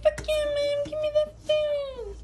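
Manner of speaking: very high squeaky pet voice, eager; voicing the dog. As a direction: speak as the greedy dog. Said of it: "You're like".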